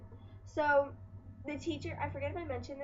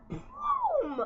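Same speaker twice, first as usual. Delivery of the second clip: excited boom; 6 dB louder than usual. Retold or imitated. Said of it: "I was like".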